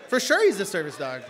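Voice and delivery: loud teasing of the congregation; teasing shout. Acted. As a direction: jab at the crowd for laughs. Teasing congregation about church.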